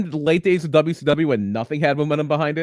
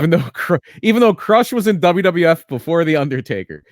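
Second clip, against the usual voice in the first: louder, exasperated protest; indignant; 2 semitones higher, 8 dB louder than usual. Real, not acted. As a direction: push it out in disbelief. Indignant rant about unfair treatment.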